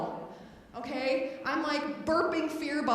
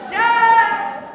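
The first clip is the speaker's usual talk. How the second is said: loud, high cheer; triumphant yell; 9 semitones higher, 17 dB louder than usual. Real, not acted. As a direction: whoop it. Phone hug cheer.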